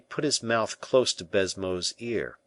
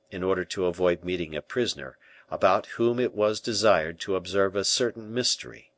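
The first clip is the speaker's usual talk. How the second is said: ordinary delivery, nothing marked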